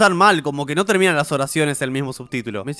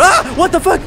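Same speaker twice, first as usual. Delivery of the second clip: startled yelp; 12 semitones higher, 6 dB louder than usual. Real, not acted. Scared by a jump scare.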